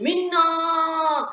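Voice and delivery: a clear high call from close by; father calling his lost daughter. Acted. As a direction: call her name so she can hear it. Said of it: "clearly she could hear her name being called".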